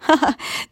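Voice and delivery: short high laugh bursting out; burst of laughter. A narrator's reading. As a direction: break into a sudden laugh. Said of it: "Esmeralda explose de rire".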